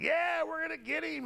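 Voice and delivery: mocking people who want to sue him, gloating. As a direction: mimic opponents gloating over him. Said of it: "everyone's like".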